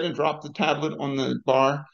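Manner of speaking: usual speaking voice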